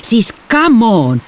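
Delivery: high, drawn-out scoff; incredulous, slow protest; exasperated disbelief. A narrator's reading. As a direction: stretch it out in sheer exasperation. Disbelief at sister's obvious lie.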